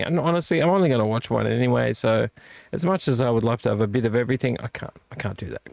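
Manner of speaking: ordinary talk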